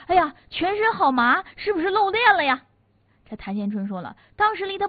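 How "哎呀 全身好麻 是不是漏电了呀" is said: high, loud cry of alarm; retold alarmed cry. Swimmer in the pool feeling numb, calling out alarmed. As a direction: call out high in sudden worry. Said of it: "有人就喊了".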